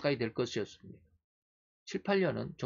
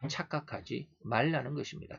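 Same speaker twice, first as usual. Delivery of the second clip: normal talking voice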